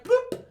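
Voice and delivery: high comic bloop; bloop sound. Retold or imitated. Imagining Jedi sending items, high cartoon bloop. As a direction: pop the word like a cartoon sound. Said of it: "they would just, like".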